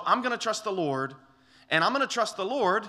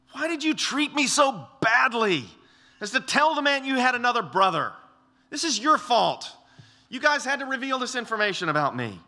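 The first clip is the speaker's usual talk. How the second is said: higher, louder mock whine from an aggrieved father; whining old Jacob; 7 semitones higher, 5 dB louder than usual. Jacob blaming his sons for his trouble, hammed-up whining. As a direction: whine out the blame like a sulking old man. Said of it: "He just starts whining".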